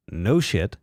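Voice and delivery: usual speaking voice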